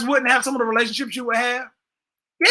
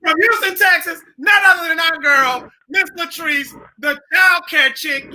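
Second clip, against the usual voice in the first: announcer introduction; 4 semitones higher, slower than usual. Acted. Host bringing the guest on stage, big slow announcer shout. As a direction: announce her like a headliner.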